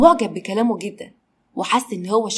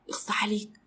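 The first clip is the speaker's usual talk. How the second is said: a hushed, reproachful shame on you; whispered scold; 10 dB quieter than usual. Acted. Malak whispers a reproach at her boss. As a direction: whisper it, scandalised.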